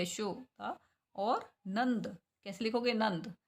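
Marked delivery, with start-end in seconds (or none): 0.0-2.2 यशोदा और नंद said very slowly for writing; slow dictation. Shown as a demonstration. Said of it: "शय की sound आ रही है".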